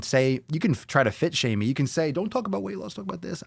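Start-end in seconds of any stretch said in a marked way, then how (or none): 2.1-3.4 high, soft put-on complainer; mock critic. Host voicing people who fit shame him, mocking. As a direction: imitate a critic in a small raised voice.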